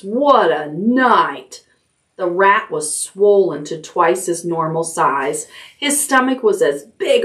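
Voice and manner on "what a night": drawn-out weary exclaim. Acted. Templeton's exhausted exclamation.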